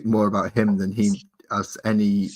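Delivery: ordinary talk, nothing marked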